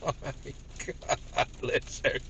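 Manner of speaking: words squeezed out through laughter; laughing hard. Real, not acted. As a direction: trail off softly into giggles. Helpless laughter after own story.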